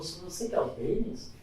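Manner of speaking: understated disbelief, hushed; imitated doubtful question. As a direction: ask as if hoping it is someone else. Recruiter taken aback seeing him.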